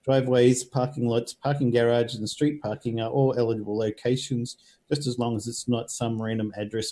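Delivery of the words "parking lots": usual speaking voice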